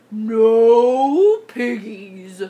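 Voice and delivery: very slow, loud bovine drawl; big, drawn-out cow voice; mooing cow. A narrator's reading. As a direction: stretch the words into a moo.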